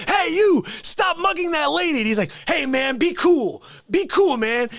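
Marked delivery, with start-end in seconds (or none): 0.1-2.0 calling out. Retold or imitated. Voicing the hero calling out. 2.5-4.7 high, mock-scared be cool; hammed-up frightened mugger; nervous pleading.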